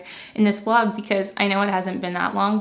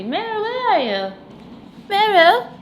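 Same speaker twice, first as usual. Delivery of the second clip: high, sing-song pet call; calling the cat; 12 semitones higher, 6 dB louder than usual. Real, not acted.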